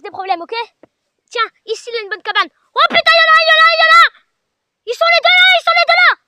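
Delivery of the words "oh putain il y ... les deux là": loud, high cries of alarm; panicked shout. Real, not acted. Spots two ghosts at once and yells to viewers in fright.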